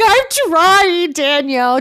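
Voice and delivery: high, drawn-out imitated plea; mock heartfelt. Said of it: "Just so heartfelt".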